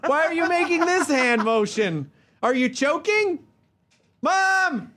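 put-on high, whiny-kid complaint to mom; high mock child voice; small child. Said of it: "I am a small child".

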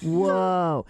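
spaced-out Cheech and Chong stoner; stoner impression. Dad like a Cheech and Chong stoner, dazed. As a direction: let out a dazed stoner whoa. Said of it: "I'm watching Cheech and Chong".

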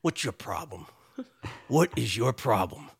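low, gruff drill sergeant voice; cousin Dennis. Retold or imitated. Cousin Dennis, the drill sergeant boss, standing over the crying young worker, in a low gruff voice. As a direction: growl it down at him like a hulking boss. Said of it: "and he goes".

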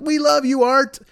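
very high adoring cheer; mock fan chorus. Everybody welcoming Artie back once clean, mock fan chorus. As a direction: coo it high as a blindly loyal crowd.

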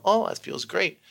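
high gushing oh; high dreamy delight; kids delighted. Retold or imitated. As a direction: gush like happy children. Kids react.

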